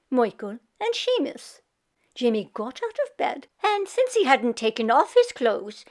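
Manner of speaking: usual speaking voice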